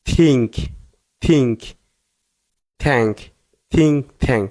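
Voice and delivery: "think, thank" begun with an aspirated t, falling. Shown as a demonstration. Teacher showing content words, th as থ in "think, thank". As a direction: puff of air on the t sound opening "think" and "thank". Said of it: "থয়ের মতো".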